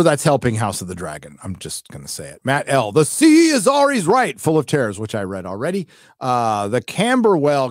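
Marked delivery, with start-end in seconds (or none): 2.9-5.0 high, loud, theatrical proclamation; dramatic recital. Acted. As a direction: declaim it like an epic bard, much higher and louder. Dramatic reading of viewer's message.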